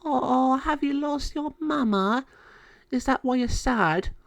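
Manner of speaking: high, gentle fairy voice full of pity. Acted. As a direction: coo it to sad kittens. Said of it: "Lilu took pity on them and said".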